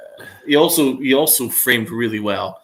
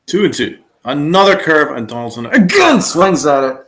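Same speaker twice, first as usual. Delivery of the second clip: raised-voice live pitch call; loud play-by-play; 3 semitones higher, 9 dB louder than usual. Real, not acted. Fan calling Donaldson's swing at another curve, loud and exasperated.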